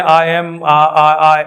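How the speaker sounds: halting, stammering on the vowel; mock getting stuck mid-sentence; a light take on a nervous learner freezing up; imitating a stuck speaker. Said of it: "अटक जाते हैं".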